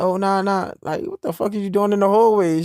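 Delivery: loud, high, startled retort; startled loud outburst. Retold or imitated. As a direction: blurt it out, caught off guard and loud. Startled girlfriend caught in hallway.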